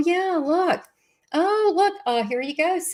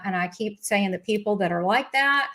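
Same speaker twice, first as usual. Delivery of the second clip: ordinary talk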